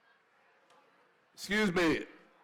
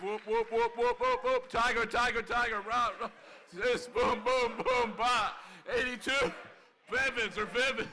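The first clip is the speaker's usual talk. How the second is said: class cheer; 6 semitones higher than usual. Real, not acted. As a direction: lead the crowd in a college cheer. Leads class locomotive cheer.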